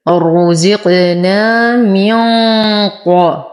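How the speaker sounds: slow "رزقنا من" with the noon held as a heavy nasal hum before qaf; إخفاء بغنة مفخمة. Shown as a demonstration. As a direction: draw out "رزقنا من", humming into the qaf. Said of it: "إخفاء حقيقي مع غنة كاملة مفخمة". ("رزقنا من" ببطء مع غنة مفخمة قبل القاف)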